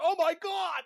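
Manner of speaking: high-pitched exaggerated alarm; mock panic. Host mimics the panic over CD piracy, mock horror. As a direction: overblown cry of horror.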